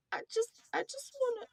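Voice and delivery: tiny high plaintive whine; small high pleading voice. Retold or imitated. S1 voicing herself, tiny high voice.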